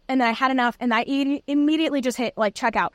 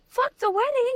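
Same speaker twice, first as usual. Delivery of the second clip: much higher, mock blunt outburst; imitating Julie; 12 semitones higher than usual. Neighbor Julie dismissing the wedding, high mock outburst. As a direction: toss it off high and cheeky. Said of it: "it was so funny".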